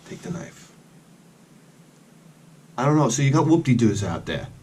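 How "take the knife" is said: whispered, secretive offer; hushed whisper. Retold or imitated. Garage sale guy whispers to the buyer, hushed. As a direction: whisper it like a sly secret gift. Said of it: "He's like".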